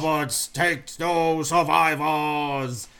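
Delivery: slow booming giant impression; Andre the Giant voice. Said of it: "while doing that voice".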